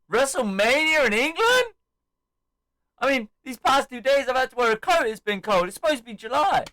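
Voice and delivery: high, incredulous wrestler's rant; re-enacted squealed disbelief; imitated scornful heel. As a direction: scoff at the very idea. Acts out Waller scoffing at England.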